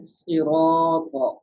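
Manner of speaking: "sirata" with "ra" drawn out; stretched long vowel. Shown as a demonstration. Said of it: "Why we are stretching the ra".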